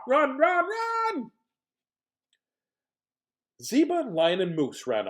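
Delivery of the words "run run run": panicked shouting. Acted. Animals flee the bear.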